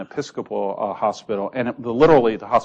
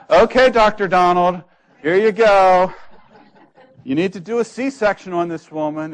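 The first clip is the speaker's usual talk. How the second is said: brisk nurse; 8 semitones higher, 7 dB louder than usual. Retold or imitated. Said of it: "some charge nurse would just grab him and say".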